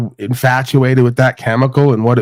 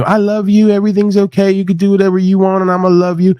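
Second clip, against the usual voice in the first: higher, sweet doting mother voice, a little fast in the middle; coddling mama; 7 semitones higher, faster than usual. Retold or imitated. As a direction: imitate a mom cooing that everything's fine. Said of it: "you just got a mama sitting there telling you".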